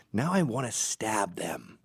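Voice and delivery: ordinary talk, nothing marked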